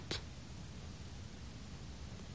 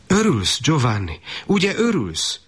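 much higher, eager mother's voice; a mother pressing her son to say he's glad; urging mother; 7 semitones higher than usual. Acted. Mother urging her returned soldier son, high and eager. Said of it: "unszolta az asszony".

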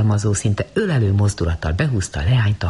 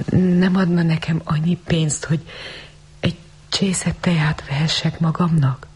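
put-on timid girl; 7 semitones higher than usual. A narrator's reading. Shivering girl begs Rosemary for tea money, timidly. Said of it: "hebegte akadozva".

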